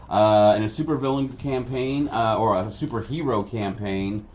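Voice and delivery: normal talking voice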